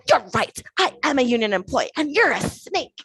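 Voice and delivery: emphatic, word-by-word mock fury; retold angry outburst. Man insults her before storming away, retold angry outburst. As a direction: mimic his parting insult with punched words. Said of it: "And then stormed away".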